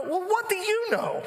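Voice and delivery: very high-pitched mock outrage; hammed-up squeal of protest; indignant retort. Frantic dad answering his doctor's reassurance, indignant.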